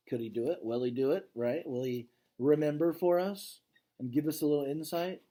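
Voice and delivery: usual speaking voice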